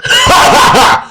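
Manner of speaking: high shouted cackle; loud hammed laugh. Boy laughing off her disbelief, loud. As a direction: belly laugh for the character. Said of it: "James started laughing".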